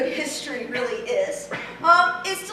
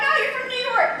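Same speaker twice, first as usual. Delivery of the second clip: lightly acted exclamation, pitched up high; mock surprised recognition; 9 semitones higher than usual. Locals greeting students off the plane in Ireland, mock surprised. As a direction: imitate someone instantly spotting where a stranger is from. People spotting students' New York accent.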